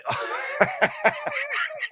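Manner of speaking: long high cackling laugh; helpless laughter. Real, not acted. Host reacting to co-host's seismograph joke, helpless laughter. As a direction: crack up completely, high-pitched.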